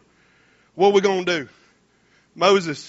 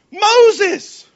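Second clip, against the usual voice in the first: high, loud single word; emphatic answer; 10 semitones higher, 7 dB louder than usual. Shown as a demonstration. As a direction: land the answer hard. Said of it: "answering his own question".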